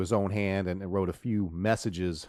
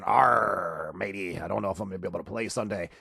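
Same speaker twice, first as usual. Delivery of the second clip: pirate voice; 3 semitones lower than usual. Retold or imitated. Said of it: "like a pirate saying".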